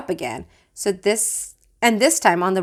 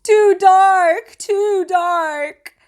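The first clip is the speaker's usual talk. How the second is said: put-on high wailing little-boy voice; crying child; 12 semitones higher, 6 dB louder, much slower than usual. A narrator's reading. Little Sam wailing at his brother's party, high and tearful. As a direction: wail it like a scared small child. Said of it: "Sam cried".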